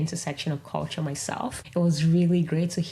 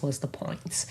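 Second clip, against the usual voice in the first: whisper; 2 semitones lower, 7 dB quieter than usual. Real, not acted. Whispered aside after fun remark.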